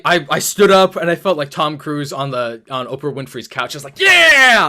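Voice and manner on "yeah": very high, loud triumphant yell; cheering. Retold or imitated. Fan reliving cheering at the reveal, loud whoop. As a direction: whoop like you just saw your hero return. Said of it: "I just cheered".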